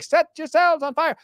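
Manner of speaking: mindless crowd echo. Retold or imitated. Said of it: "they'd be like".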